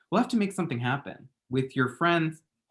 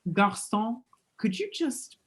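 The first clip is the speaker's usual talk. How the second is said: higher affected French call; fake French; 9 semitones higher, slower than usual. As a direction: summon the waiter in faux French. Calling waiter affectedly.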